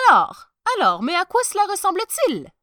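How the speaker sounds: can't wait to hear; eager cry. Acted. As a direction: emperor crying out excitedly. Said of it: "s'écria l'empereur".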